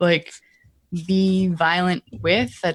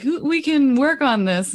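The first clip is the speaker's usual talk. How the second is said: slow, higher-pitched mock priest; drawn-out pastoral soothing, half-acted; priestly imitation; 6 semitones higher than usual. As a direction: lightly parody a priest's reassurance. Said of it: "doing your whole, like, priestly".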